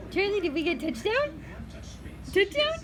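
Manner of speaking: high coaxing dog talk. Real, not acted. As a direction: coo questions at the dog playfully.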